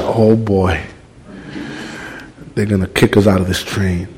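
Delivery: low, soft nervous murmur; worried aside. Retold or imitated. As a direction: dread the big guys in headsets. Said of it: "and I'm going".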